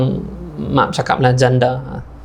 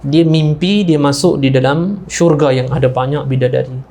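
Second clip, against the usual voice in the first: ordinary talk, nothing marked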